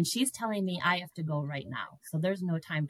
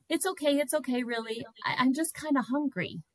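higher, a touch embarrassed; lightly voiced embarrassed patient; sheepish admission; 6 semitones higher than usual. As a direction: own up sheepishly to being hungry. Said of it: "And I'm like".

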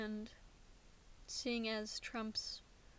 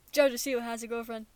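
excited; 8 dB louder, faster than usual. Real, not acted. Repeats news excitedly.

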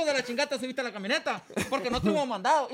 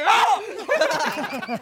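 loud, raised answering yell; re-enacted own scream; 9 semitones higher, 9 dB louder than usual. Driver retelling how he screamed too, re-enacted yell. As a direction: act out screaming right back, loudly. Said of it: "yo también grité".

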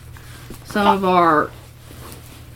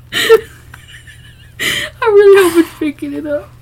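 trying to talk while crying with laughter; laughing fit; 11 semitones higher than usual. Real, not acted. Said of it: "Can you stop laughing? It's making me laugh".

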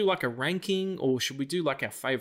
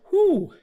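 relieved whew; 9 dB louder than usual. Retold or imitated. Relief after averted fight.

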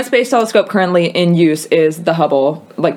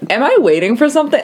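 quick, high-pitched rude imitation; 7 semitones higher, faster than usual. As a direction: imitate an impatient, rude question. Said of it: "that sounded really rude".

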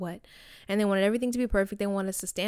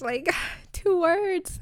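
high, slow, laughing two words; giddy squeal; 9 semitones higher, much slower than usual. Retold or imitated. Replaying her crush's reply, high giddy laughing.

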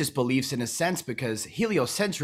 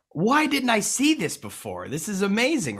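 excited realization; 7 semitones higher than usual. Retold or imitated. Host retelling his reaction to the interview, excited and amazed. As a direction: act out being amazed, stretching it out high. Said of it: "and I'm like".

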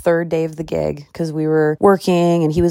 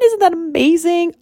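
high, thrilled exclamation; over-the-moon excitement; excited squeal; 13 semitones higher, 3 dB louder than usual. Real, not acted. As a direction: gush with delight. Excited about brother's premiere.

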